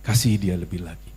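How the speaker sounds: low, hushed divine answer; voice of God. Acted. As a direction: speak as God, calm and low. God answers the praying man. (suara Tuhan yang rendah dan tenang)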